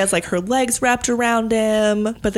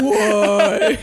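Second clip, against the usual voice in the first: laughing caveman grunt. Acted. Caveman bit continued, laughing.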